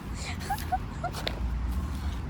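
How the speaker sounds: relieved laugh. Real, not acted. Relief after spider.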